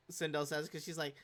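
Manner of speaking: normal talking voice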